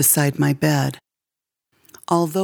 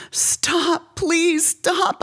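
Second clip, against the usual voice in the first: much higher, tearful begging; crying child; 12 semitones higher, much slower than usual. A narrator's reading. Girl curled in a ball begging stepfather, crying high. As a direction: sob it out like a beaten little girl. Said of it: "I cried".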